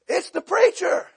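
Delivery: high-pitched household announcement; mock-panicked warning to the family; imitated alarm. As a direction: call it out high. Household announces preacher's arrival.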